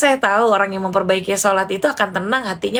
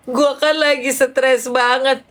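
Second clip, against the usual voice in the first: slow, higher, whining confession; tearful friend; 4 semitones higher, slower than usual. Retold or imitated. Stressed friend confides in the speaker, tearful. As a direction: imitate a friend confiding through tears.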